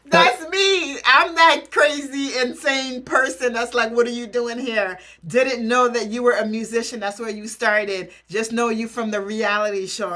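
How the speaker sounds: loud, high, fired-up confession; loud gleeful self-call-out; excited outburst. Real, not acted. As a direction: shout it out, thrilled to be that fan. Reactor admits being that fan.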